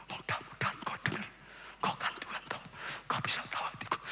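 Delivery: hushed, very soft prayer; timid whisper. Shown as a demonstration. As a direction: whisper politely, hoping to be heard. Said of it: "aku pelan-pelan saja". (doa berbisik yang sangat pelan)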